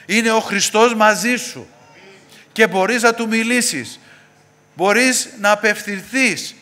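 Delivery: high, loud sermon assurance, building; rousing preaching. Real, not acted. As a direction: proclaim it to each listener, slowing on the last phrases. Preaches encouragement at full force. (δυνατό κήρυγμα ενθάρρυνσης με υψωμένη φωνή)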